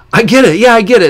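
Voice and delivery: high, loud, over-eager I get it; fake eager agreement. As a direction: pretend you get it to fit in. Kid pretends to get joke.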